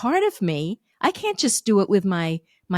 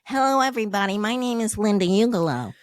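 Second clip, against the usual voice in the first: put-on character voice, smoothly connected; character demo introduction; faster than usual. Shown as a demonstration. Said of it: "Do it once through saying".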